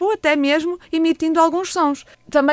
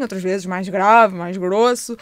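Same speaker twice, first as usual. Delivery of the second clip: deeper, slower voice; low-pitched; 4 semitones lower, slower than usual. Shown as a demonstration. Therapist showing parents pitches for baby, low-pitched. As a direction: drop the voice down low. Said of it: "com frequências".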